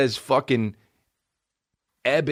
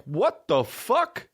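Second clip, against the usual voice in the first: higher, slow mock disbelief; slow high mock indignation; imitated outrage; 10 semitones higher, much slower than usual. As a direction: drag it out offended. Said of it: "if the guy is like".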